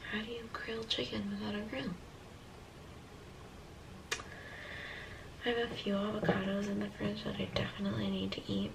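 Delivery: hushed whisper so others sleep. Real, not acted. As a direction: whisper softly, people asleep nearby. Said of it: "I'm whispering 'cause people are sleeping".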